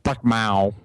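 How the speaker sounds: commercial cat doing a hen's cluck; playful animal-noise impression; imitated cat clucking. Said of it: "The cat's all Buck Mao".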